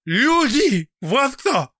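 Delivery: high, loud bitter shout. A narrator's reading. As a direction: fling the word out in a shout. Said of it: "закричал Алексей Георгиевич".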